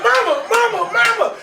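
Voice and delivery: put-on very high pleading child voice; high crying kid. Boy begging his mama to stop, high wail.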